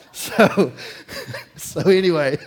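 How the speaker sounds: real laughter breaking into the words; can't stop laughing. Real, not acted. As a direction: laugh through it, loud. Cracking up mid-sermon.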